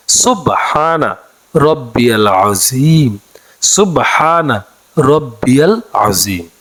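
slow, drawn-out recitation of সুবহানা রব্বিয়াল আযীম; model recitation. Shown as a demonstration. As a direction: chant it slowly so students can follow. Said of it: "রুকুর তাসবিহটি হচ্ছে".

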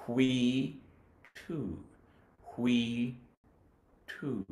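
whee held then soft two; whee, two repeated softly; held syllables. Shown as a demonstration. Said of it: "hold the first syllable while they say the second".

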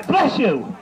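ordinary talk

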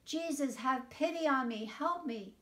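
calling out. A narrator's reading. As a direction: call out to Jesus as he passes by. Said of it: "he called out".

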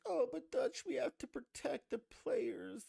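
high, whiny mock voice, slow on the second line; mocking whiny objector. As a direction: squeak out the critic's complaint like a pushover. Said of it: "And they're like".

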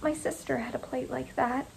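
very soft, muted voice; hushed, withdrawn murmur. A narrator's reading. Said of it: "her voice was muted".

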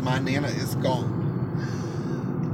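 very slow, hushed words of loss; grief-stricken. Retold or imitated. Telling mother of the death, slow stunned grief. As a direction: slowly say the words you can barely get out. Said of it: "The hardest words I've ever had to say".

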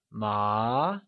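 "ma" drawn out, gliding upward; mid-rising tone. Shown as a demonstration. Teacher gives the answer meaning hemp, "ma" in rising tone. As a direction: start "ma" low and climb. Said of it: "and in this tone".

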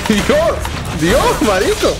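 amazed, laughing at the combo; excited disbelief. Real, not acted. Gamer amazed by a huge combo, laughing and high.

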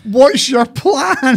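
high, slow, incredulous parody; mocking drawn-out echo. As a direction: stretch it out in mock disbelief.

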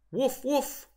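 high-pitched pretend woofs; imitated dog bark. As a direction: yap like a turkey posing as a dog. Turkey imitates a dog barking.

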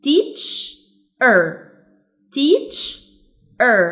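teacher split as teach, pause, er; syllable by syllable. Shown as a demonstration. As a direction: break teacher into two separate sounds. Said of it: "احنا قسمناها ازاي". (مقطّعة إلى مقطعين بينهما سكتة)

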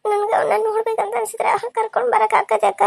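high, plaintive request; tearful begging. Acted. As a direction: beg the nurse to let you see your baby. Mother begs nurse to see baby. (ಅಳುದನಿಯಲ್ಲಿ ಬೇಡಿಕೊಳ್ಳುವುದು)

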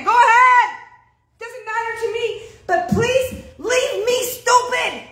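high, loud defiant cry. Acted. Preacher defying church doubters, high and loud. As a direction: throw it back at the doubters, slowing on please leave me stupid.

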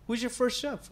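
cashier questioning customer. Acted. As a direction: lightly play an inquisitive cashier. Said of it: "you give answers like".

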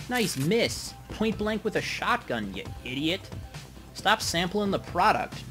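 loud frustrated shout; exasperated yelling. Real, not acted. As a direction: bark at a useless teammate. Yelling at teammate's miss.

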